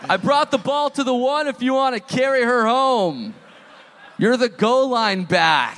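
high-pitched, hammed-up bro boast; mock bro taunt. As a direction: play the taunting bro, stretching the ending. Carries on the ex's taunt.

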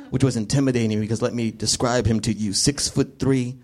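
normal talking voice

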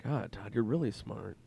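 tinge of sarcasm. Real, not acted. Guest compliments Todd, sounding faintly sarcastic. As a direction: praise him with a touch of sarcasm. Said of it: "it sounds like I'm being sarcastic".

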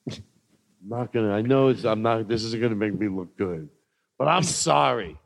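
ordinary talk

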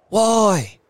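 loud, high, baffled question; marvelling. A narrator's reading. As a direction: ask it in astonishment.

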